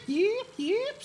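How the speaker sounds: high squeaky yip yip; yappy chihuahua voice. Shown as a demonstration. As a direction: yap like a tiny dog. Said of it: "make a sound like a yip".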